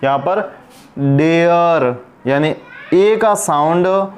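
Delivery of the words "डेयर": डेयर stretched slowly as "day-uhr"; teacher's slow model of डेयर; two-part vowel. Shown as a demonstration. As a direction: sound out डेयर, holding "ay" before "uh". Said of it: "ए की मात्रा और अ आ रहा है".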